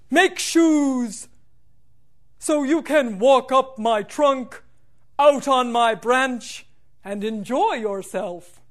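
put-on slow, steady old tree voice; deep rich tree voice. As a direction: speak like a rooted old tree. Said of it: "in a deep, rich voice".

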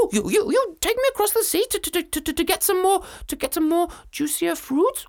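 high, loud giggling monkey. Acted. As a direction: stammer it out like a giddy monkey. Monkey voiced in story.

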